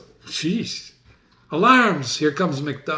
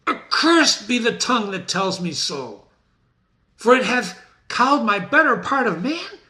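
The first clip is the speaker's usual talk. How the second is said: higher, anguished defeated king; despairing Macbeth; 7 semitones higher than usual. Acted. Macbeth refusing to fight Macduff, higher anguished. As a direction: let the fear break through.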